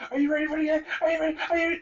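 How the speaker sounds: high, pumped-up hype man voice. Retold or imitated. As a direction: rev up the crowd like a hype man. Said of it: "they got a professional hype man".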